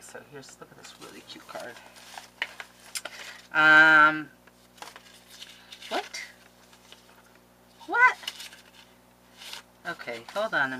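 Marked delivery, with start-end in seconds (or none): 0.0-1.7 soft unvoiced whisper. Real, not acted. Whispering to viewers about a cute card. 5.9-8.2 sharp high startled what; surprised. Real, not acted. Reacting to a gift card, high surprised.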